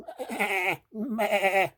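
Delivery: high quavering baa; imitated high sheep baas; sheep bleat. A narrator's reading. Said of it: "Says the sheep".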